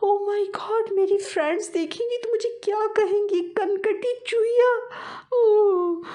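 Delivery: hammed-up crying wail, very high. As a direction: wail over the cut ear, crying. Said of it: "चीखती चिल्लाती हुई दौड़ी".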